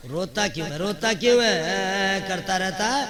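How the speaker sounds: loud, high mock scolding; scolding father. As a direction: berate the crying child, hammed up. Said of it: "تو باپ کہتا ہے".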